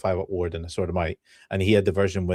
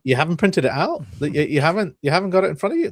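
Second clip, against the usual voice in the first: mock disbelief; 6 semitones higher, 3 dB louder, faster than usual. Teasing Josh for not printing.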